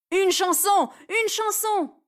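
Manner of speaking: high chant; calling out. Acted. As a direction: chant it at her. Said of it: "crie Rémi".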